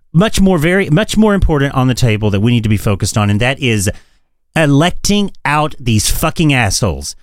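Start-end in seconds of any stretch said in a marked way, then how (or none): none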